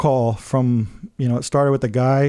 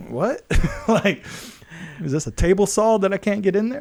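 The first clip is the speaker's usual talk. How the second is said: higher, half-laughing retold disbelief; stunned disbelief; 5 semitones higher than usual. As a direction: act baffled, laughing at the absurdity. Retold shock at the huge offer.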